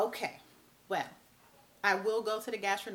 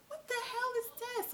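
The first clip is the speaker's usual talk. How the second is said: baffled outburst. Retold or imitated. Baffled by strange chest pain.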